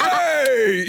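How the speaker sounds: very high mock shout; startled dad's shout. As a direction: yelp it like an alarmed driver. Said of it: "He was like".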